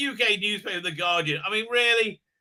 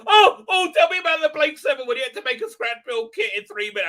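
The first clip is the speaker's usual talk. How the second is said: much higher, shouting, giddy fan excitement; gushing fanboy; 7 semitones higher, 7 dB louder than usual. Retold or imitated. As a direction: gush like a starstruck geek. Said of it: "I'll be like".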